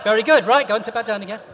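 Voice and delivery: usual speaking voice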